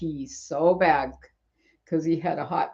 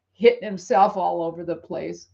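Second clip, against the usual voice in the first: usual speaking voice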